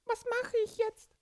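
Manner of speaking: high squeaky little creature voice; small child voice. Acted. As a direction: speak like a tiny excited child. Borki in the water.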